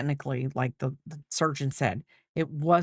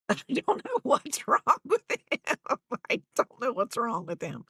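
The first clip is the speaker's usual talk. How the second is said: laughing; 8 semitones higher than usual. Real, not acted. Laughing at her dog.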